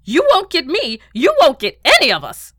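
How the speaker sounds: high, loud, defiant yell; shouting. Acted. Beetle launching a weapon at his enemies, shouting.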